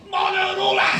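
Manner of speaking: high-pitched Scouse call; Scouse teacher. Retold or imitated. Scouse teacher calling to the pupil on the top deck, high Scouse yell. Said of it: "Mister Townsend with his Scouse voice".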